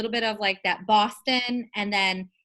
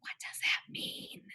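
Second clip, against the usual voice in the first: hushed baffled aside; 9 dB quieter, slower than usual. Retold or imitated. Retelling baffled reply.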